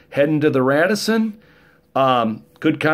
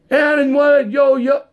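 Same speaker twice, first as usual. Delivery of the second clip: high, loud yo-yo taunt; mock rapper; 12 semitones higher, 4 dB louder, slower than usual. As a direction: brush him off in rapper slang. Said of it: "And he said to me".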